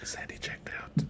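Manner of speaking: unvoiced whispered aside; whispering. Real, not acted. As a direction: whisper it to the side. Aside about a co-host.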